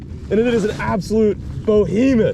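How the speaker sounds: real elated shouting, climbing very high; high-pitched victory yell; triumphant celebration. Real, not acted. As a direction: whoop and holler over a huge catch. Celebrating the catch.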